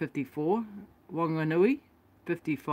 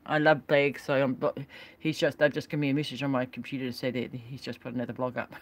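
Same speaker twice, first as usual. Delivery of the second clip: usual speaking voice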